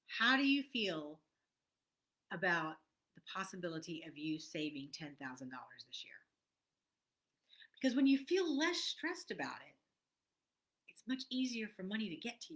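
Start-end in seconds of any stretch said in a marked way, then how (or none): none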